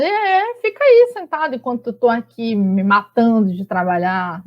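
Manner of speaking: ironic complaint with a jump up at the start; sarcastic. Acted. Overworked wife to seated husband, sarcastic complaint. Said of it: "sarcástica, irônica. Ela tá dizendo".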